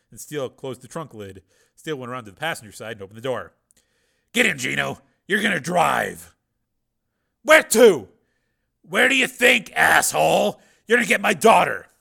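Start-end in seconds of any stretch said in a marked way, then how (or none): 4.3-6.3 commanding. A narrator's reading. Hero orders. 7.4-8.1 thug voice. A narrator's reading. Thug asks. 8.8-11.9 angry. A narrator's reading. Angry hero.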